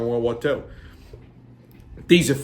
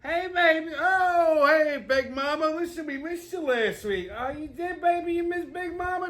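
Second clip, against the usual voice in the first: much higher, hammed-up family characters greeting; high put-on sketch voices. Sketch characters greet the host in high hammed-up voices.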